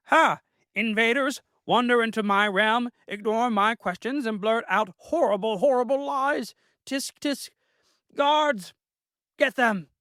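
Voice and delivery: very high, indignant squeaky royal voice; a huffy little king losing his temper; perturbed rabbit king. Acted. The Rabbit King orders his guards to attack, high and indignant. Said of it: "He spoke again, quite perturbed".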